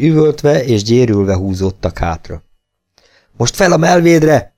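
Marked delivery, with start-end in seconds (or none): 3.4-4.5 higher, louder and slow, calling the men up; a rallying shout, each word driven out; shouted command. A narrator's reading. Berg urges the men up to the rampart: slow raised shout. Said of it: "kiáltott Berg".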